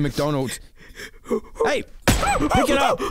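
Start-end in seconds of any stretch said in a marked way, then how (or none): 1.6-3.0 very high, slow order; squeaky command. Acted. Squeaking at the monkey to pick up the food.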